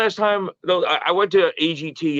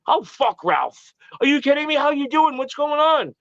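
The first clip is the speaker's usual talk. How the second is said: retold brush-off rising into high-pitched welcome; sincere happy greeting; 11 semitones higher than usual. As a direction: imitate someone thrilled to see you. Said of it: "so sincere and so happy to see me".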